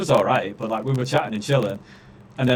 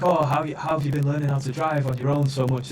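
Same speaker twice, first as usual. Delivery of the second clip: a mildly higher, gushing imitation of the instructors; mock fawning examiners; 3 semitones higher than usual. Ranting learner mimics instructors praising the other rider, lightly gushing. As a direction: ham up how they fussed over him. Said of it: "they've been like".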